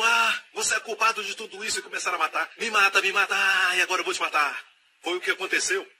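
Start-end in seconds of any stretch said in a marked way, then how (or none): 0.0-1.8 higher, shouted blame; enemy soldier turns on a comrade, angry shout; angry accusation. Acted. Enemies accuse each other. 2.6-4.7 louder, higher mock brawl; hammed-up fighting. Enemies killing each other, acted.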